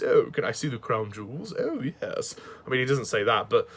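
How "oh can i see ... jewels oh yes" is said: camp it up as a plummy English toff; posh voice. Retold or imitated. Reviewer imitating Richard Mace's posh English accent. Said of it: "He talks in a very posh voice like this".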